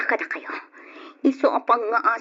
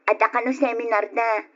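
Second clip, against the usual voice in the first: usual speaking voice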